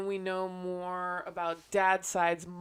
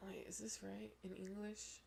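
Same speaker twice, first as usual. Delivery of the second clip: soft unvoiced whisper; 16 dB quieter than usual. Real, not acted. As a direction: whisper it to yourself. Aside while searching on phone.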